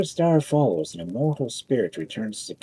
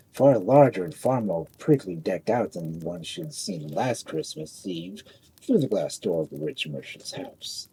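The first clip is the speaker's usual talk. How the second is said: plain reading voice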